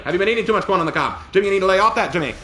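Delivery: put-on fast, loud old-time announcer; old radio announcer. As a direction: rattle it off like vintage radio. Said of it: "Back to you".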